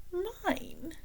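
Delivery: high, soft amazed question; wide-eyed. A narrator's reading. King astonished to get a medal, high and hushed. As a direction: breathe it out in disbelief. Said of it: "said the king, wide-eyed".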